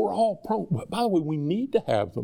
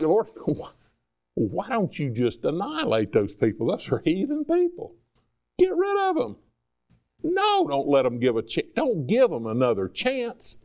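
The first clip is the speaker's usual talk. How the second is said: hammed-up outrage, pitch climbing way higher on "Get rid of them"; mock indignant Jonah; 3 semitones higher than usual. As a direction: play a petulant prophet begging God to wipe them out. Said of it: "He's like".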